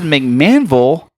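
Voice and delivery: drawn-out, incredulous question. Retold or imitated. Husband retelling his reaction to wife's choice of town, incredulous. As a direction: stretch the place name out in disbelief. Incredulous at proposed far destination.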